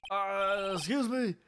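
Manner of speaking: high, jittery, stammering; nervous officer voice. Acted.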